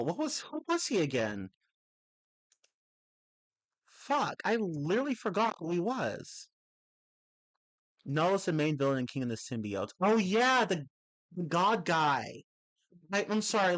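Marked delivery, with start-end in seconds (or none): 3.9-6.5 sudden higher curse; frustrated outburst. Real, not acted. Frustrated at forgetting. 10.0-12.4 louder, higher oh yeah of remembering; light up as it comes back; sudden realization. Real, not acted.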